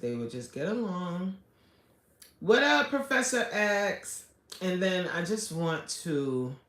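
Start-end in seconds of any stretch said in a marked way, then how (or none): 2.4-4.2 high, bright call out to a viewer; excited greeting. Real, not acted. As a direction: sing out a hello to the chatter.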